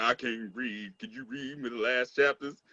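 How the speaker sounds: mock sad. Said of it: "He was all sad".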